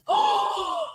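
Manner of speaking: sharp high mock gasp. Reacting to the host being a spy, high gasp.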